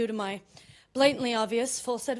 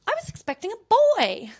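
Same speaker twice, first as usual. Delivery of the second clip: lightly acted higher-pitched nurse; nurse, apologetic; 9 semitones higher than usual. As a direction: apologize in a high, surprised voice. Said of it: "She goes".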